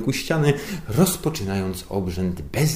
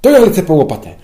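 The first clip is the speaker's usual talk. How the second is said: loud, much higher eager blurt; eager underling; 10 semitones higher, 15 dB louder, faster than usual. A narrator's reading. Underling dashing off for a shovel, loud and eager. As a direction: rush it out, keen to dig.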